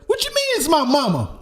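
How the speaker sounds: high-pitched, loud outraged question; indignant line read. Acted. Actor performs a cold-read line he was killing, indignant. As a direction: act out an affronted script line.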